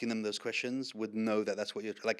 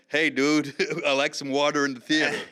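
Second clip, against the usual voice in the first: louder put-on American accent; jokey American dude accent; mock American dude; 5 dB louder than usual. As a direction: goof on an American dude, half laughing. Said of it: "I'm not gonna be like".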